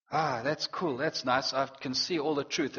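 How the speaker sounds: low, soft, casual musing; understated mock "that's nice" reaction; mock mild appreciation. Preacher mimics someone reading the Bible alone, casually appreciative.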